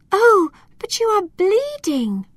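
high-pitched cry of concern; alarmed cry. A narrator's reading. Girl sees the elf's wound, alarmed cry. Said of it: "cried Alma".

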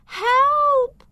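very high, loud cry for help; terrified shout. A narrator's reading. Terrified witch in the dragon's grip, shouting. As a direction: shout for rescue, stretching the word. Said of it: "and shouted".

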